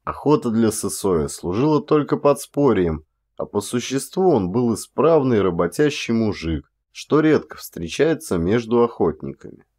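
ordinary delivery

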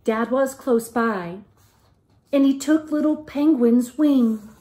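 lively, sing-song picture-book reading; reading to children. A narrator's reading. Teacher reading a picture book aloud to the class, lively and sing-song. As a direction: tell it like a bedtime story to little ones.

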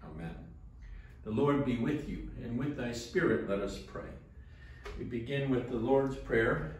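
usual speaking voice

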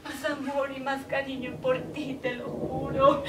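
ordinary talk

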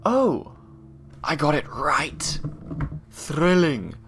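thrilled shout of success; excited. Real, not acted. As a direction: cheer at getting it right. Solves the puzzle.

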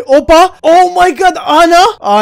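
high, loud burst of shock at finding her; surprised shout. Real, not acted. Gamer finding his captured friend, shocked shout. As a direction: yelp out as if you just spotted her. Finds Ana locked in cage.

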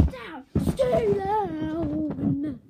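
slow, loud, stretched order; drawn-out loud command. Acted. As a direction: hold each word down loud. Ninja move demo.

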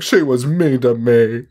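slow, hammed-up whine with a laugh; mock crying. Crying aunt complaining about being accused, mock crying. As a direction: imitate a tearful complaint, stretched out. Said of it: "The aunt's crying, being like".

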